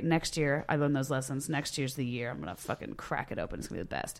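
low, soft self-talk fading to a whisper; retold private pep talk, dwindling away; hushed resolve.

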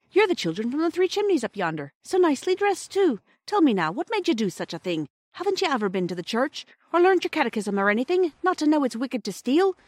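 high-pitched, incredulous questioning; scolding station master. A narrator's reading. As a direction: chide the children in disbelief.

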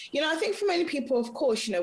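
usual speaking voice

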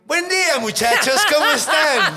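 high, sing-song cheerful greeting; chirpy tailor. Retold or imitated. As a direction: breeze in like a cartoon character. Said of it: "siento que justo es así". (saludo alegre y agudo de caricatura)

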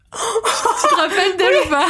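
high-pitched, laughing question. Real, not acted. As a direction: ask it through a burst of real laughter. Hilarité au souvenir de la prof. (en riant, voix très aiguë)

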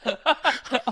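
high squeaky wheeze; gasping wheezy laugh; wheezing laugh. Real, not acted. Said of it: "the wheezing laugh".

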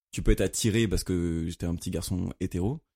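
ordinary talk

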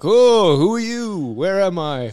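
high, loud, lost and bewildered; hammed-up bewildered old man; confused Lincoln. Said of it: "talking to people like".

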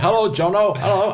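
high, laughing, cheery mock greeting; Parkinson's greeting. Imitating Michael Parkinson greeting him over lunch, high and laughing. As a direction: sing out the hello like a delighted old host. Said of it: "He went".

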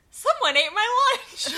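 retold indignant shout. Coworker finding his lunch gone, mock outraged shout. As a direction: belt it like a wronged coworker. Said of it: "and I hear".

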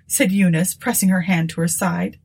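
ordinary delivery, nothing marked

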